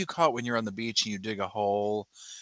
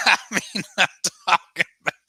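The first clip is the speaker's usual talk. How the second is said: laughing; 10 semitones higher, 5 dB louder, faster than usual. Real, not acted.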